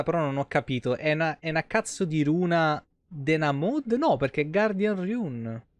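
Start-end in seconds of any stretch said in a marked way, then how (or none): none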